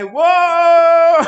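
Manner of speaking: sudden high, loud startled yelp; mock scream. Acting out being pushed from the plane, high loud yelp. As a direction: shriek as if shoved out of the plane. Acted skydiving jump, pushed out.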